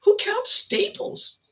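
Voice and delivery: high, slow, disbelieving; incredulous cashier. Retold or imitated. Retelling the shopper asking who counts staples, baffled. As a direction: stretch the question out, baffled he counted them. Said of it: "And he said".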